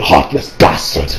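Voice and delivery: slow, breathy near-whisper; Vegeta's insult. Retold or imitated. Reviewer voicing Vegeta's slow, breathy insult. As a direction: let the insult fade into a rasp. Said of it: "It's like".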